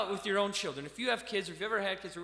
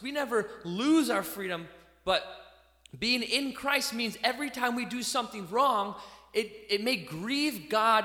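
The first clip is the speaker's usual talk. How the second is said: normal talking voice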